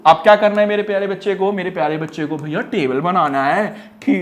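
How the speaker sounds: usual speaking voice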